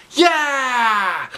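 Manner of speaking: high loud cheer; excited yell. Acted. Kids cheer for a chocolate party, yelling. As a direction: shout for joy. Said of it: "everybody yelled".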